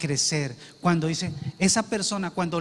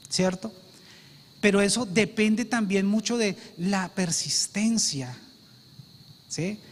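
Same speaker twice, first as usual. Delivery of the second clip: usual speaking voice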